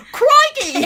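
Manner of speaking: high, loud mock Australian crikey. Teasing the co-host's reading, mock Australian yelp. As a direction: parody an Aussie exclamation. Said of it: "as you're like".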